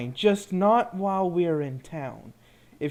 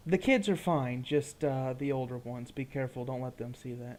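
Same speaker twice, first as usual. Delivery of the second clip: much softer, leaned-in murmur; hushed warning; 8 semitones lower, 10 dB quieter than usual. Acted. Companion quietly warns the wizard about magic, hushed. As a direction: keep it low so the town won't hear.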